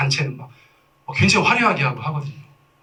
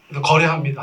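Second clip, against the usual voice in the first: ordinary talk, nothing marked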